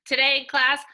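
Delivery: ordinary delivery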